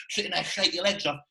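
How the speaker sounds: usual speaking voice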